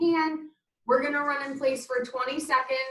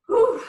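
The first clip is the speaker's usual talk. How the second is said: loud high exhale after sprint; breathless whew; 11 semitones higher, 8 dB louder than usual. Real, not acted. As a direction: blow out hard, spent. Big exhale after running.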